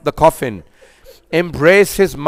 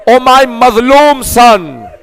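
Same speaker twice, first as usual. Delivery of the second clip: higher, louder, slow crying call; anguished plea; 6 semitones higher, 4 dB louder, slower than usual. Acted. Preacher voicing Fatima calling Hussein, anguished crying plea. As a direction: wail it out like a grieving mother.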